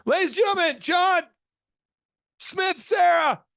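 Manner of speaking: high, loud announcement with names jumbled; slow botched introduction shouted out; nervous shout. Retold or imitated. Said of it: "He'd be like".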